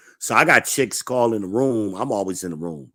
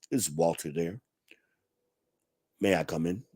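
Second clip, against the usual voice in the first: lower, quiet, proper and assertive; a hint of a formal, imposing father; deep proper dad voice; 5 semitones lower, 9 dB quieter than usual. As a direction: play the stern proper father asking at the door. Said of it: "he had a deep voice just like that".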